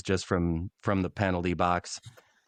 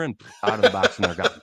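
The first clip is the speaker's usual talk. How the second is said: high, laughing through words; 11 semitones higher, 7 dB louder than usual. Real, not acted. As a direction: laugh out the ending.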